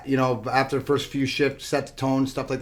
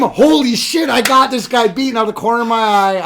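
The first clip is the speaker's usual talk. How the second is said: higher and louder, thrilled disbelief; excited inner voice; 7 semitones higher, 6 dB louder than usual. Retold or imitated. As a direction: relive the moment you beat him. Reliving his big goal rush.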